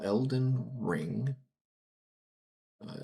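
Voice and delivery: usual speaking voice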